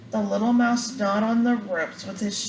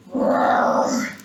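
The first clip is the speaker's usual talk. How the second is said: breathy, roaring lion growl; 5 dB louder than usual. A narrator's reading. The lion roars at the tickling mouse, growling. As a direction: roar like a big lion woken up. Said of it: "roared the lion".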